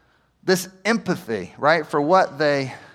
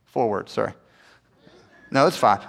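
usual speaking voice

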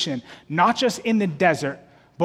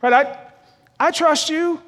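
hesitant believer; 7 semitones higher than usual. Retold or imitated.

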